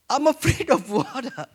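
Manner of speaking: ordinary delivery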